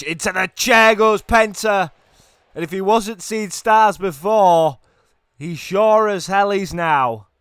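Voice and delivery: high, loud, drawn-out commentary; excited match call. Real, not acted. Commentator calling a chair spot, excited. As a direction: belt out the big hit.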